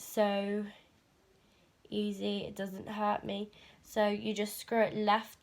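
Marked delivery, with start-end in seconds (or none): none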